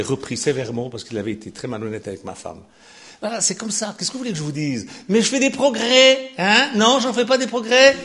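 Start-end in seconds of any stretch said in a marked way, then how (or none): 5.1-8.0 high, loud mock pleading for approval; playful teasing. Pastor teasing his flock, high and loud. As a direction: fish for the congregation's praise.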